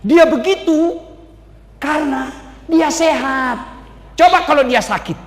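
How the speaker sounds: lightly voiced devil objecting; scoffing devil. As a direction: scoff at God's praise. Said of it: "Apa kata setan?".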